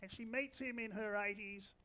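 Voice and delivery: ordinary talk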